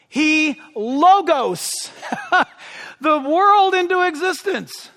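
high, drawn-out word breaking into a laugh; amused delight. Real, not acted. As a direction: laugh mid-sentence, pitched high with delight. Delighted laugh at his own wording.

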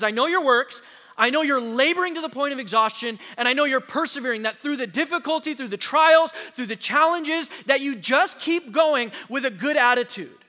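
rising preached praise, voice of Jesus; building preached quote. Acted. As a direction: swell it phrase by phrase.

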